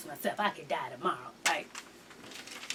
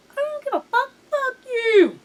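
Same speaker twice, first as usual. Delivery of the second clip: high-pitched play-acted comeback; mock defiance; 10 semitones higher than usual. Host answering a listener's insult, mock defiance. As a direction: squeal it back as pretend indignation.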